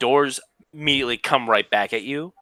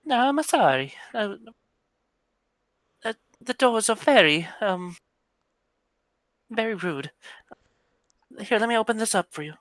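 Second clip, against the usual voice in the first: put-on higher, light young woman voice; 11 semitones higher than usual. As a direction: play her sweet and polite.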